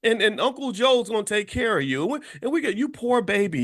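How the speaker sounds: put-on baby him like a doting relative; mock pity. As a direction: enabler coddling someone in a wheelchair, mock pity. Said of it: "They, they say".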